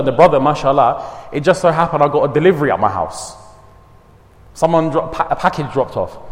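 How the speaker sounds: ordinary delivery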